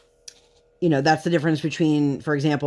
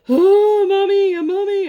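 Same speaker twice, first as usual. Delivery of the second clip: put-on high, loud wailing "mommy" like a small child; whiny little girl; 7 dB louder than usual. Mom imitating her daughter's loud, high whine. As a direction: cry for mommy like a helpless four-year-old.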